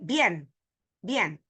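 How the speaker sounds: bien as one syllable, "byen"; correct diphthong. Shown as a demonstration. Teacher giving an example word, joined diphthong. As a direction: glide quickly from i into e in bien. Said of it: "it's not bien".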